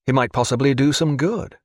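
ordinary delivery

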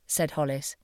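usual speaking voice

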